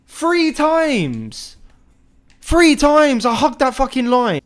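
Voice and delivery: high, loud indignant yell. Real, not acted. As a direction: rage at the injustice. Complaining about defending.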